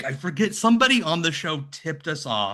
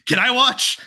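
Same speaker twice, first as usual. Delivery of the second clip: gleeful request; 9 semitones higher, 6 dB louder than usual. Retold or imitated. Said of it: "He's like".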